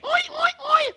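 high squealing pig oi. Acted. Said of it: "And all the animals say".

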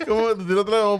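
laughing. Real, not acted. Laughing at the story.

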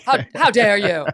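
high, loud mock outrage; hammed-up indignant "how dare you"; mock indignation. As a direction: play the wounded victim, hammed up. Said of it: "playing the victim here".

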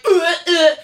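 mock disgusted ugh; disgusted groans. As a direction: wince and groan. Flinching at gory scene.

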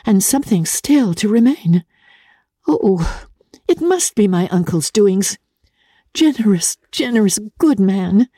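put-on a bright young woman thinking it through; voiced young heroine. Elizabeth voiced in story.

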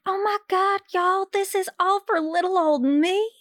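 mock Southern gush. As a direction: play a naive small-town Southern girl in a Southern accent. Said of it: "being like".

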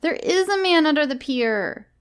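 put-on high-pitched stubborn child voice; sulky child. A narrator's reading. Miranda insists on her story, sulky and high. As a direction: insist sulkily like a small child. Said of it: "said Miranda sulkily".

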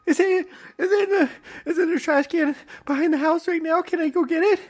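high, wailing mock sob; hammed-up tearful begging; mock crying. Boy begs to fetch it from the trash, high mock sob. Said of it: "I'm just start crying".

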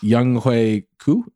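put-on slow careful "Young Hoi Koo", each syllable spelled out; proper Korean name. Shown as a demonstration. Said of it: "the proper way to pronounce his name".

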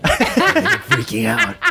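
laughing panic. Acted. [name] repeats his panic to Callie, laughing and high. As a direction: squeal it out in giggling mock panic.

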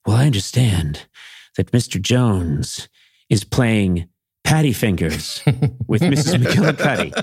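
a lightly done prim mother passing on gossip; proper old-fashioned mother. Retold or imitated. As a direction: savor the scandal like a respectable matron. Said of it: "my mom would actually say".

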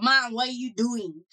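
lightly voiced little daughter, slow and careful; a hint of a speech-delayed child asking mom, slowed down; imitating speech-delayed daughter. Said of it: "she sounds speech delayed".

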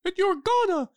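very high falsetto mouse voice; cartoon mouse. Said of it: "the mouse".

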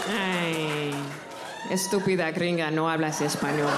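put-on low, scolding old abuela; tut-tut at the gringa; great grandma voice. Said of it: "my great grandma's voice".

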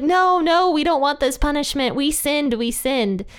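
high, whiny begging voice; mock pleading crowd. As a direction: ham up the panicked plea.

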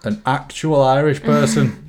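slow, high, laughing mock disbelief. Real, not acted. Teasing her co-host about the Irish client, laughing slowly. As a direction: drag it out through a laugh. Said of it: "mocking disbelief, laughing".

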